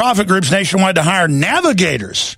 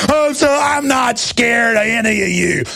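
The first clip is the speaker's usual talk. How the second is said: high, wailing mock whimper; mock begging; 7 semitones higher than usual. Host mocks cowards begging officials, high and wailing. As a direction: ham up a cowering coward pleading.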